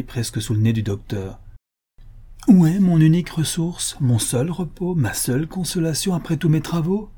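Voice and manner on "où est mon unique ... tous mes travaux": heated, high-pitched protest; impassioned outburst. A narrator's reading. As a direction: pour out the questions in rising desperation. Sick man's outburst over his bottle.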